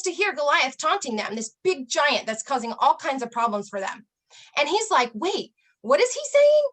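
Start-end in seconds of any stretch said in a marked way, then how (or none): 5.1-6.6 put-on high, indignant "Wait, what is he saying?" in a boyish voice; startled disbelief. Young David hearing Goliath's insult, much higher and startled. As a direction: leap up in pitch, shocked.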